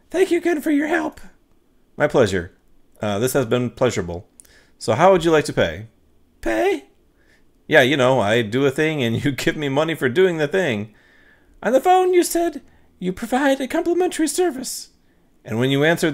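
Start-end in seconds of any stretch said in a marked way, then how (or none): 0.1-1.2 much higher polite woman's voice; woman client. Acted. Anna thanks the ghost hunter, much higher woman's voice. 6.4-6.9 much higher polite woman's voice; woman client. Acted. Anna thanks the ghost hunter, much higher woman's voice. 11.6-14.9 much higher polite woman's voice; woman client. Acted. Anna thanks the ghost hunter, much higher woman's voice.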